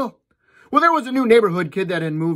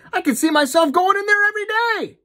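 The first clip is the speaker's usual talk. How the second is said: excited Johnny; 12 semitones higher than usual. Acted. Johnny thrilled.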